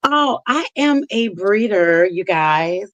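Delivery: normal talking voice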